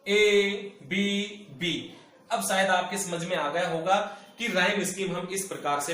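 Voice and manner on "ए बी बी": ए, बी, बी very slow and drawn out; slow teacher recital of the scheme; spelled out slowly. Shown as a demonstration. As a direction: lay out the letters one by one for the class. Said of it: "rhyme scheme होगी वो होगी ए".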